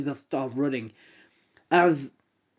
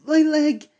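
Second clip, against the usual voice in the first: high, loud yelp of pain; pained cry; 12 semitones higher, 4 dB louder than usual. Acted. Injured woman cries out about her leg, high pained yelp. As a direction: cry out as the knee scrapes. Woman trips and grazes knee.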